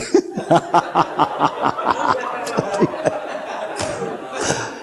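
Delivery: breathy, airy laugh at the joke; a soft laugh at his own story. Real, not acted. Preacher laughing at his own joke, airy.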